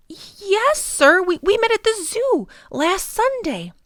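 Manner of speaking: put-on a boy answering an adult politely; mild child voice.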